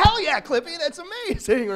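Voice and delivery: mock thrilled shout; excited yes. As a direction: gush like you just got rescued. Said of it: "You're like".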